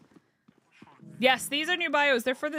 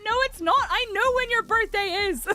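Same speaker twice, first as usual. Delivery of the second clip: high, louder teasing no; playful telling-off; 11 semitones higher, 5 dB louder than usual. Retold or imitated. Narrator teasing the toddler about her birthday. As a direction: tease the toddler kindly. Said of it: "And I went".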